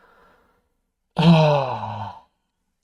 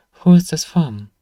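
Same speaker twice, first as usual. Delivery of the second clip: abrupt louder, higher question; surprised question; 5 semitones higher, 10 dB louder than usual. Acted. Shopkeeper startled by the mystery letter, surprised.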